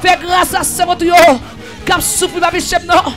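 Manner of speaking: ordinary delivery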